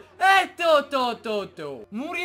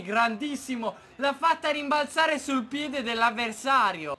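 normal talking voice

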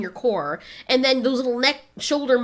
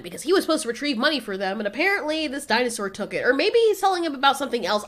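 usual speaking voice

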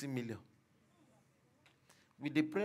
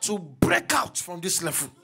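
shouted, high-pitched preaching cries; full-force preaching; 8 semitones higher, 23 dB louder than usual. Acted. Pastor urging the congregation to shout the prayer, full-force preaching. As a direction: roar encouragement over the crowd.

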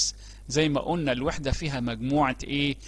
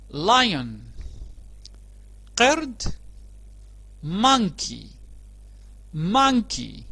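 each word called out higher and louder, spaced pauses; vocabulary drill; 5 semitones higher, 4 dB louder, much slower than usual. Acted. Teacher leading kids through new words, loud and clear. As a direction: model every word for children to echo.

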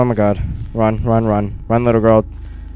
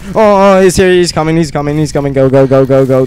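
alarmed; 4 semitones higher, 6 dB louder, faster than usual. Real, not acted. Sees Slender, panics.

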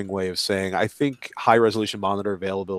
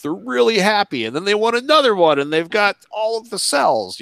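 gushing delight; 12 semitones higher, 5 dB louder than usual. Retold or imitated. Accountant's joy with big monitors.

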